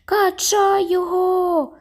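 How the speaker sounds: very high, slow cheering shout; crowd cheer. A narrator's reading. Fan urges the crowd to toss the boy, shouting. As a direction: roar it out to the crowd.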